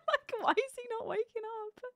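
squealing laugh, then high disbelief. Real, not acted. Laughs at passed-out character.

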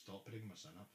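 low, quiet warning; contained, low-voiced demand. Real, not acted. Low warning during a heated argument.

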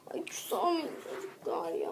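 soft pleading whisper. Acted. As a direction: coax them in a whisper. (fısıltıyla)

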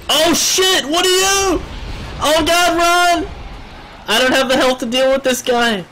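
very high, loud shriek; panicked scream. Real, not acted. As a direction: scream in alarm and flee. Surprised by a dangerous enemy.

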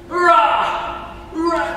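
playful high mock roar; lion roar. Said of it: "lion press ups".

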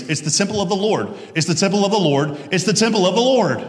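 urgent prophet driving the point home; insistent repetition. Acted. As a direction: hammer the same phrase three times, rapidly. Prophet repeating phrase three times.

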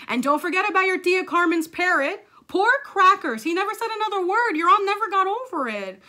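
high, loud exasperated mom; worked-up, overblown motherly rant; escalating scolding. Acted. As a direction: pile on the reproach dramatically.

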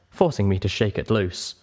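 ordinary delivery, nothing marked

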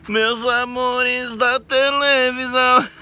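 high, slow crooner greeting his fans. Retold or imitated. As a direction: croon it like the singer on TV. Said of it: "como é que eu tô parecendo o [name]".

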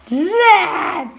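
loud, high mock roar; imitated roar. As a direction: roar out a short loud "ram". Imitates a noise from another video. (yüksek sesle kükreme taklidi)

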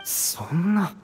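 soft, quiet, choked dismay; stunned despair. Acted. Ogzu refusing to believe, softly choked. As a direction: let it break quietly. Devastated, crying sound.

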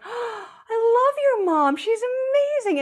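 high, gushing praise of your mom; a friend swooning over someone's mother. Retold or imitated. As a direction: rave about her like a charmed guest. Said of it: "And they're like".

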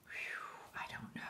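soft concentrated whisper. Real, not acted. Vlogger whispers to viewers while stamping. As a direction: whisper while you focus on the nail. Unsure of the result.

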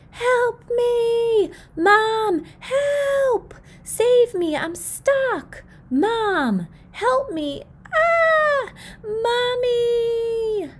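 put-on high, loud desperate child's cries; shouting for help. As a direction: scream for mom from the clothesline. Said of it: "I shout for help as loud as I can".

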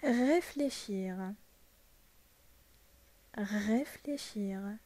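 Réfléchir drawn out syllable by syllable, re-flé-chir; slow model word. Shown as a demonstration. Teacher slowly models Réfléchir for students, drawn out. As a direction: spell out Réfléchir slowly for the class. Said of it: "Ça se prononce comme ça".

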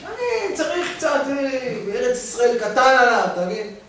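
son's voice pitched well up and pleading; lightly hammed son begging dad; high pleading son. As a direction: play the spoiled son, voice high. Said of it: "הבן שלו אמר לו".

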